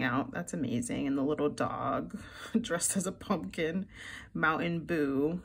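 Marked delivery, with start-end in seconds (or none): none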